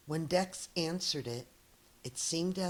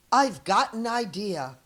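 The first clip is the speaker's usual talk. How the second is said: louder eager shout; 4 semitones higher, 7 dB louder than usual. Acted. Dex shouting his idea to the crowd. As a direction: announce the plan to the crowd. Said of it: "Dex shouted".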